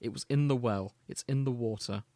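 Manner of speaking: usual speaking voice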